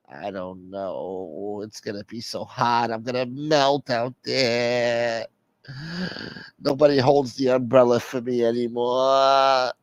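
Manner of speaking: slow, drawn-out mock whine; whiny caller, self-pitying. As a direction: drag out the complaint as if about to cry.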